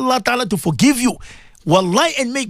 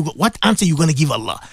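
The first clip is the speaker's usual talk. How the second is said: ordinary talk, nothing marked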